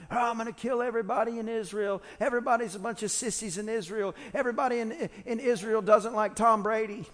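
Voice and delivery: mock the enemy army with a hint of a bragging giant; taunting giant. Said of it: "the giant starts screaming out".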